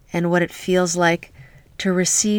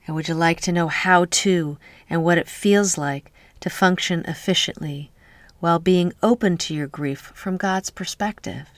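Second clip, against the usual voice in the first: normal talking voice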